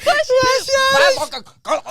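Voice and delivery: very high, drawn-out laughing squeal; loud cracked-up imitation; hysterical mimicry. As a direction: squeal the mimicked line, falling apart laughing. Repeats accented line while cracking up.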